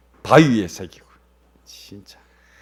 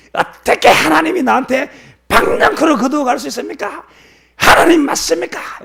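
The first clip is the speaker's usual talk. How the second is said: very high, loud, strained protest; angry outburst at God; 8 dB louder than usual. Acted. Jonah rails at God for taking his gourd, furious. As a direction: shout the accusation upward in outrage. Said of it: "이렇게 이야기하면서 화를 내니까".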